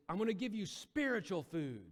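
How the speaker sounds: high, loud proclaiming voice; proclaiming Jesus. Retold or imitated. Jesus proclaiming to the crowd, high and loud. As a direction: announce it grandly to the crowd.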